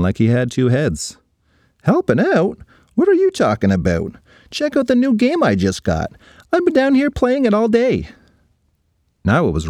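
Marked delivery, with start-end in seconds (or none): 1.8-8.1 put-on high, carefree boy voice; a lazy kid bragging about his game; kid Christopher. Christopher to Ryan about his new game, high carefree boy.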